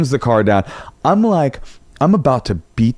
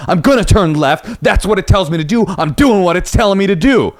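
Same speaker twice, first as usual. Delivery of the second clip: higher, insistent mock driver; mock defensive Uber driver; 9 semitones higher than usual. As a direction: imitate a stubborn driver pushing back. Driver arguing back.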